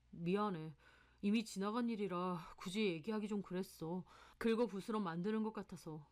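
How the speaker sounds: a hint of a subdued boyfriend explaining himself; lightly voiced apologetic boyfriend. Boyfriend explains his broken engagement to his girlfriend, apologetic. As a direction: lightly play the boyfriend making excuses.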